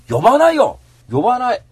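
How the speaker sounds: outraged shouting at a news article; indignant shout. Real, not acted. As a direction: yell back at the writer. Angry rant about an article.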